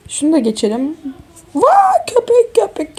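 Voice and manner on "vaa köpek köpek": surprised. Real, not acted. Sudden dog startles her.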